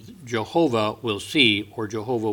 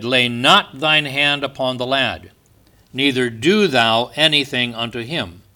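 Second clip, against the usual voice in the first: raised, solemn, declaiming reading of scripture; 6 dB louder than usual. Acted. Preacher reading Genesis to his congregation, solemn declaiming. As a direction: proclaim the verses to the congregation. Reads Genesis aloud in sermon.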